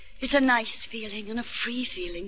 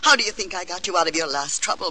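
usual speaking voice

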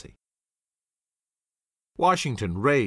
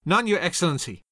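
higher, firm call above the chatter; loud enough over the din; 8 semitones higher, faster than usual. Acted. Hamilton answering Washington, raised above the din. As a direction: cut through the room. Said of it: "in a voice loud enough to rise above the din".

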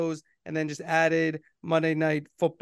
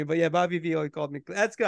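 ordinary talk

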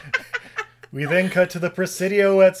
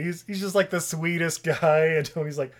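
normal talking voice

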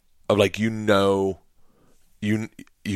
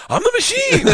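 very high, comic exclamation; exuberant boast. Retold or imitated. Comic repeating his mistake to laughing gangster, exuberant. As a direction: squeal it out proudly.

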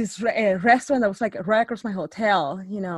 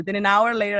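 ordinary talk, nothing marked

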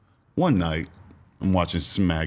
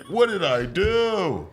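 panicked pleading; 11 semitones higher, slower than usual. Retold or imitated. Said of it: "You know what I mean?".

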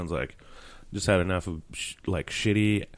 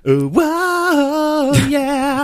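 mock ad gasp; 6 dB louder, much slower than usual. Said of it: "They're just like".

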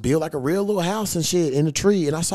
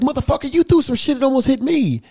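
heated, raised pitch, pushing back hard; louder, higher, indignant protest; angry retort; 11 semitones higher, 4 dB louder than usual. Retold or imitated. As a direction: snap back at the guy who threw water. Retold confrontation, angry retort.

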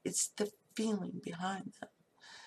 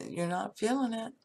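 usual speaking voice

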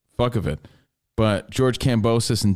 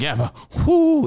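high, slow whoo; imitating Iowa Sam; slower than usual. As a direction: squeal it out oddly. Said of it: "He's like".

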